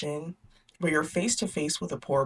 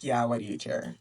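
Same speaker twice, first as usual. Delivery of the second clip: dismissive uncle; 8 semitones lower, 4 dB quieter than usual. Acted. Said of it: "And Uncle's like".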